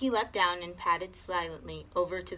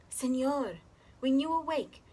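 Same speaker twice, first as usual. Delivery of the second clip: put-on murmuring child; 6 semitones higher than usual. A narrator's reading. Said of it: "He murmured in his ear".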